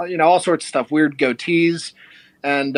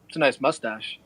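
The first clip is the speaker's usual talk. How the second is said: Brian's deadpan; 3 semitones lower, 7 dB quieter than usual. Retold or imitated. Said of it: "He's got a really dry humor".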